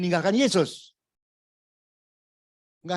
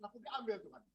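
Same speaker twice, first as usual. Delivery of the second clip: mock joyful hallelujah; 6 semitones higher, 4 dB quieter than usual. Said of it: "Udah gila tuh orang tua".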